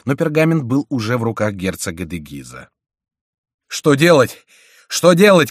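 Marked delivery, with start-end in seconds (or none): 3.7-5.5 high, loud cry of alarm, rising on the repeat; breathless shout. A narrator's reading. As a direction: shout it urgently, more urgent the second time. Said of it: "кричал, запыхавшись, монах". (тревожный крик)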